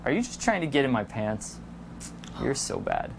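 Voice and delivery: low, soft playful accusation; hushed mock suspicion, pitched low; teasing accusation. Shown as a demonstration. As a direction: accuse teasingly, dropping to a whisper.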